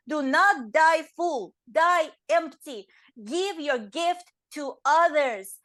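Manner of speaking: slow, weighty motivational delivery; slow emphatic advice. Retold or imitated. Quoting a motivational speaker to listeners, slow and weighty. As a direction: spell out each word like a coach.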